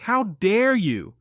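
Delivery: slow, high indignation; slow, offended scolding; indignant. Retold or imitated. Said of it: "this look on her face like".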